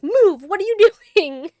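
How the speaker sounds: sudden loud yell, high and loud; freaked-out yell. Retold or imitated. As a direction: bark it out in panic. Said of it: "he just freaks out".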